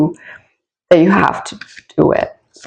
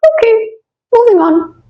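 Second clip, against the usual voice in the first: high, lilting la la la, unbothered; breezy sing-song in a higher voice; carefree sing-song. Retold or imitated. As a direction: playfully hum through the chaos. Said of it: "so much that you're like".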